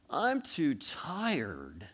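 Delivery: slow, soft drawn-out excuse; lazy child whining; tired kid excuse. Acted. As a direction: drag it out like a sleepy child. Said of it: "same thing".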